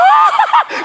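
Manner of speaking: high-pitched mock fangirl squeal; swooning women's squeal. Women swooning over the handsome pastor, mock squeal. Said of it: "Yung mga babae".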